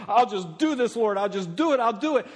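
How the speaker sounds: high, urgent volunteering; eager insistence. Acted. Believer offering to do it for the Lord, eager. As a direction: pile up the promises, pushing upward.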